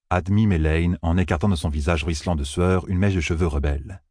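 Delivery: ordinary delivery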